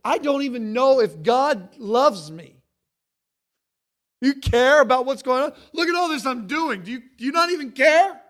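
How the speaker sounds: loud, high, rushed accusing whine; exasperated complaint. Retold or imitated. Overwhelmed believer complaining to God, loud exasperated whine. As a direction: pile on the grievance, fed up. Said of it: "frustration and aggravation sets in".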